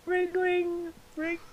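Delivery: soft, high ringing sound; small high ring-ring; imitated phone ring. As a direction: mimic a telephone, very quietly.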